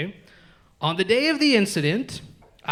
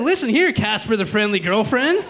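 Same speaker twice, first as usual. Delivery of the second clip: mock scolding shout; 6 semitones higher than usual. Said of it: "I said".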